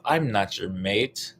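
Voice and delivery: usual speaking voice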